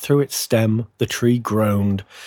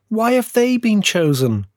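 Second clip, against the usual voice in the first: high, aggrieved little tree; bitter; 11 semitones higher than usual. A narrator's reading. Little fir seeing other trees chosen, high and bitter. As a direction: protest it high, with a sulky bitterness. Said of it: "it said bitterly".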